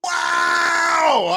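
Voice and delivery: high, loud, drawn-out wow; real goal-celebration cry; thrilled exclamation. Real, not acted. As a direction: let out a long whoop of amazement.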